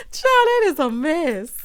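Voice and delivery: loud, high, drawn-out exclamation; slow, high-pitched amused outburst. Real, not acted. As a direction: cry it out, tickled, straight after laughing. Exclaims after laughing hard.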